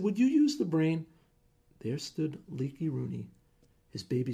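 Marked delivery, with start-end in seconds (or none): 0.0-1.1 exasperated. Acted. Wife exasperated with husband.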